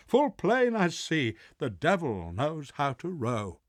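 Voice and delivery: high, loud manic cry; the mad boy shouting at the oars; crazed boy. Acted. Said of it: "who now doth crazy go".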